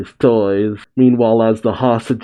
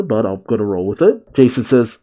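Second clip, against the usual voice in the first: usual speaking voice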